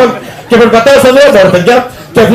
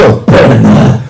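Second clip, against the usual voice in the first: low, gruff joke voice answering the door; mock gravelly gatekeeper; deep mock doorman voice; 10 semitones lower than usual. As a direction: drop way down like a bouncer.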